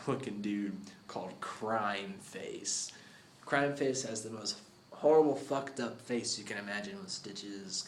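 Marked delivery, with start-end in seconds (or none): 0.1-2.9 hushed, breathy menace on the name; spooky whisper. Acted. Telling a friend about the villain, in a spooky whisper.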